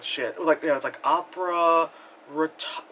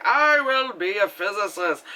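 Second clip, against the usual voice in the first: slow, higher put-on kid voice; young child self; 4 semitones higher than usual. Young self announcing a career dream, put-on child voice. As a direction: declare it as an earnest little child. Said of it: "a very young, young child of myself".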